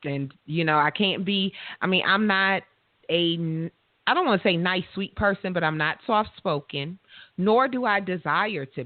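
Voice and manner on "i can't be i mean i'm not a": usual speaking voice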